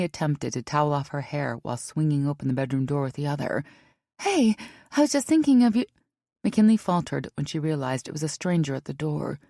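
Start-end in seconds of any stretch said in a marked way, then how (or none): 4.2-5.8 put-on high, eager young woman; bright greeting. A narrator's reading. McKinley greets at door.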